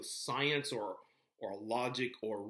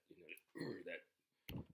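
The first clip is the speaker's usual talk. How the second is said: usual speaking voice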